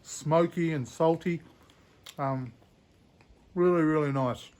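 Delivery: talking through a mouthful; mouth full. Real, not acted. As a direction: chew and talk at once. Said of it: "when I got my mouth full".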